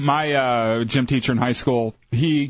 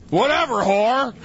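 put-on high, slow sneering drunk yell; drunk guy yell; 8 semitones higher than usual. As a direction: spit it out like a sloppy drunk. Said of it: "I always do it when I'm drunk".

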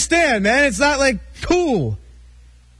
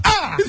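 pained yelp; 8 semitones higher than usual. Real, not acted. Shocked by trick pen.

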